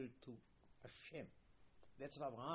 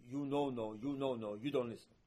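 louder, insistent telling-off; mock scolding; 2 semitones lower, 6 dB louder than usual. Father rebukes his disobedient child, scolding. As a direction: scold the child lightly but firmly. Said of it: "did not want to embarrass the child".

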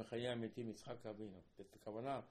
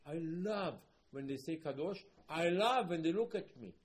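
raised, building declaration, leaning on "love"; emphatic delight; 4 semitones higher, 8 dB louder than usual. Retold or imitated. Hashem speaking of Kedusha, acted with emphatic delight. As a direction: declare it louder the second time.

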